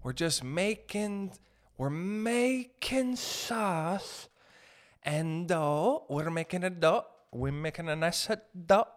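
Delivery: drawn-out, sing-song mock Italian delivery; mock Italian cook. Italians talking with their hands over dough, mock drawn-out delivery. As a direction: stretch the words like an Italian cook.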